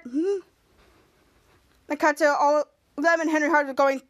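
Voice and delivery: ordinary talk, nothing marked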